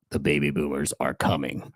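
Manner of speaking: low, ominous warning voice; spooky voice. Shown as a demonstration. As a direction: say it like a ghost story. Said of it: "I'm gonna make it sound as spooky as I can".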